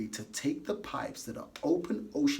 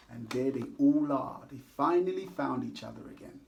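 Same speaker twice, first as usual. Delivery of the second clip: plain reading voice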